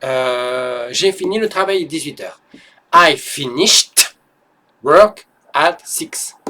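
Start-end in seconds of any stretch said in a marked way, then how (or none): 2.9-6.4 "finished" with the final t exaggerated, slow. Shown as a demonstration. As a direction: overstress the t at the end of "finished". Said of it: "j'exagère le t. C'est fait exprès".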